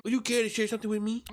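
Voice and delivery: a curious professor fishing politely; amicable professor. Acted. GM voices Naismith, amicable.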